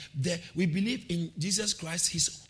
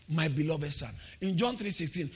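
ordinary delivery

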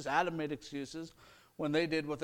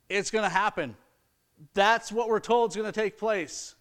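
It's gonna happen said louder and higher, stress on "gonna"; emphatic; 5 semitones higher, 7 dB louder than usual. Real, not acted. Preacher telling the congregation judgment is certain, loud and emphatic. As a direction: declare it loudly as certain.

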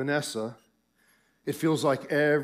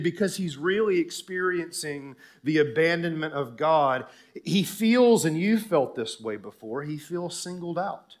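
ordinary talk, nothing marked

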